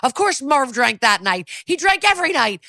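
losing his cool, pitch climbing; heated outburst. Retold or imitated. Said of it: "Chet is heating up fast".

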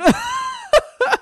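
big high cackling laugh; loud burst of laughter. Real, not acted. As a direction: bursts out laughing at the awkward switch. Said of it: "laugh after sponsor name".

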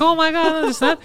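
high, excited babble in her voice; mock freaking out. Boyfriend retelling his girlfriend's reaction, mock freaking out. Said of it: "she's freaking out".

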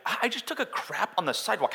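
high, fast mock-indignant answer; exasperated retort. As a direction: fire back a comically blunt confession. Acted blunt answer to friendly greeting.

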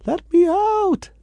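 high muffled yell from inside a trunk; muffled shout. Retold or imitated. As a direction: cry out from a locked boot. Trapped man shouting from luggage compartment.

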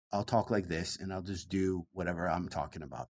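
soft, low, easygoing talk; cranked down for slow listeners. Shown as a demonstration. Comic demonstrates talking down for southern crowds, low and soft. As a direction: drop it down low and quiet. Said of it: "I talk too fast for them".

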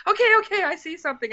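high, hurried retold alarm, easing off; mock startled. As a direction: back off from a sudden apparition, replayed. Startled, backing off from the apparition.

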